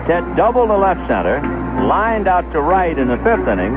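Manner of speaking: ordinary talk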